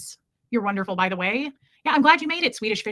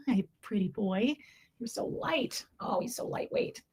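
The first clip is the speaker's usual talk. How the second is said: cooing to the cat; 9 dB quieter, slower than usual. Real, not acted. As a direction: murmur tenderly to the cat in your arms.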